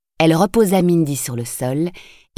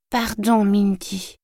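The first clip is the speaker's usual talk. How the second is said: lightly voiced little boy, higher, grudging; a hint of a sulky small boy; mumbled boy's apology; 5 semitones higher, much slower than usual. A narrator's reading. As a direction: mumble a sheepish sorry to his sister. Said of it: "marmonna le petit garçon".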